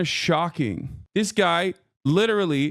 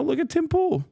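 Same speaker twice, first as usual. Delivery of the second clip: ordinary delivery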